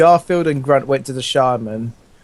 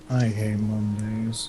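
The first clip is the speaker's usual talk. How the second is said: low, slow, quiet Snape drawl; a hushed Snape voice, in no hurry; subtle Snape; 5 semitones lower, 9 dB quieter, much slower than usual. Retold or imitated. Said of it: "Sounded like a subtle, uh, Snape".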